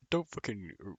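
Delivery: ordinary delivery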